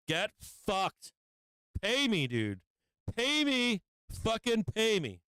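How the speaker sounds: high-pitched, exasperated demand; angry demand. Real, not acted. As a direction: demand it with your pitch jumping up. Angry demand at the losing trade.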